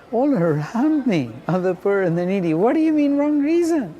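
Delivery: high, loud incredulous outburst; climbing to a shocked cry of disbelief; flabbergasted. Retold or imitated. Young man protesting to Mother Teresa, flabbergasted and loud. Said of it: "he was flabbergasted".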